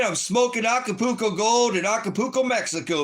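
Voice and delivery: mock vacation hype; excited livestreamer. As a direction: brag to the camera like a partying tourist. Pretends to livestream from Mexico.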